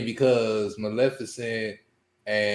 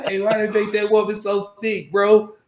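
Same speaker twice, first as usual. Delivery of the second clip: rowdy friend; 7 semitones higher than usual. Retold or imitated. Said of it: "We do that's every time".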